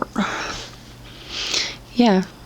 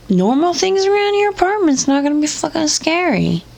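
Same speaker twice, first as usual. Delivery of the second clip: exasperated; 9 semitones higher than usual. Real, not acted. Exasperated rant at the poster.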